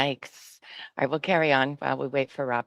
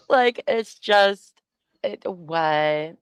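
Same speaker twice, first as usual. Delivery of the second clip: normal talking voice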